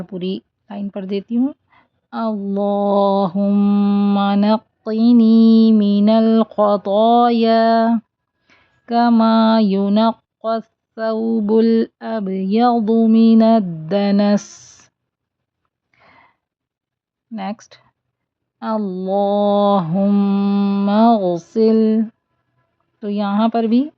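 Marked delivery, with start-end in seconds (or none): 2.1-14.8 slow melodic Arabic recitation; slow chanted dua; Quran-style recitation. Shown as a demonstration. Recites full line. 18.6-22.0 slow melodic Arabic recitation; slow chanted dua; Quran-style recitation. Shown as a demonstration. Recites phrase.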